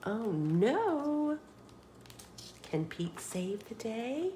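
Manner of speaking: usual speaking voice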